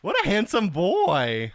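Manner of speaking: slow, high, doting praise. Real, not acted. Owner doting on his dog Toby, slow, high and cooing.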